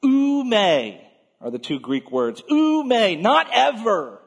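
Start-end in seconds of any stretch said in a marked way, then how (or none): 0.0-1.0 "Ou me" loud and high, falling; emphatic negative. Retold or imitated. Preacher voicing Peter's Greek negative, emphatic. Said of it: "there's another emphatic statement, a negative". 2.5-4.2 "Ou me, not ever" high and loud; emphatic negative. Retold or imitated. Peter refuses to let Jesus wash his feet, emphatic. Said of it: "there's another emphatic statement, a negative".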